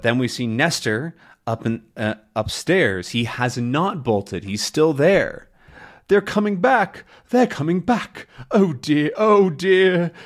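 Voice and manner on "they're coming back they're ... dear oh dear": high-pitched, fretful butler; acted alarm. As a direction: fret anxiously as danger returns. Butler panics seeing them return.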